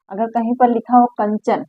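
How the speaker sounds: normal talking voice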